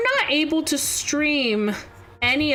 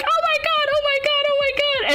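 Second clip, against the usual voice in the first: high, frantic repeated oh my god; imitating a panicked squad shrieking; friends losing it; 14 semitones higher, faster than usual. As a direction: squeal it like the whole team just died. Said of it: "hear everybody losing it".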